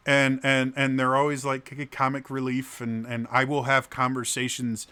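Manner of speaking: ordinary delivery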